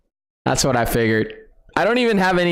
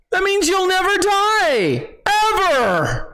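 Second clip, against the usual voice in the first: excited rant; 10 semitones higher than usual. Real, not acted. Excited rant about the feature.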